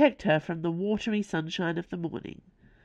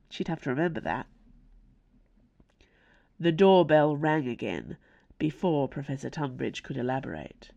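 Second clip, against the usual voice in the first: plain reading voice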